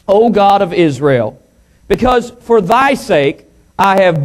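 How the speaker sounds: projected, measured pulpit reading; scripture reading. Real, not acted. Preacher reading psalm to congregation, scripture reading. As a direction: proclaim it verse by verse.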